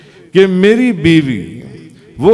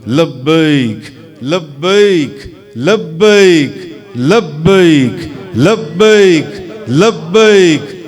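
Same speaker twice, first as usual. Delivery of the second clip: Moses answering; much slower than usual. Acted. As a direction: chant لبیک again and again, building up. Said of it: "تو اب حضرت موسیٰ علیہ السلام". (موسیٰ کی بڑھتی ہوئی لبیک کی صدا)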